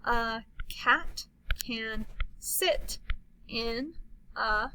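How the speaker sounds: slow, word-by-word reading; slow modeled reading. Shown as a demonstration. Teacher modeling a sentence, slowly. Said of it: "let you go first".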